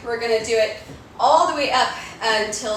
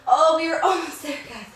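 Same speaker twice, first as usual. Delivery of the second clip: workout coach counting; 5 semitones higher, slower than usual. Real, not acted. Coach counts reps aloud.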